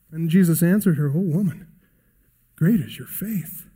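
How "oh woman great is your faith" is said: tender praise. Acted. Jesus praising woman's faith.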